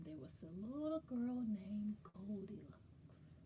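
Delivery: quick conspiratorial whisper. Acted. Storyteller teasing children, whispered. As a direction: let them in on a secret.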